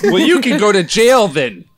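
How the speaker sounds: mock outburst. Co-host retorting to host's dumb-idea remark, loud mock outrage. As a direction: fire it back in playful outrage.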